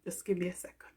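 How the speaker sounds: fighting back tears, then a whisper; real tears catching in the throat; emotional. Real, not acted. Talking to her viewers while getting emotional, whispering. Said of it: "I'm feeling so emotional".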